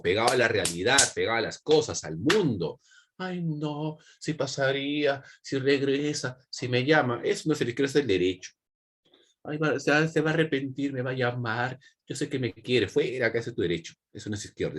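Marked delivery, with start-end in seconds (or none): 3.2-7.2 higher, drawn-out wistful whine; mock lovelorn pining. Teacher imitates a lovesick student hoping for a return, mock pining. Mimics lovelorn right-brained person. 9.4-12.9 high, wishful lovesick voice; mock lovelorn hope. Teacher mocks a romantic sure the ex will call, mock pining. Mimics lovelorn hopeful person.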